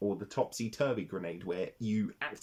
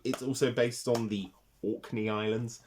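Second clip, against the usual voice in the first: ordinary talk, nothing marked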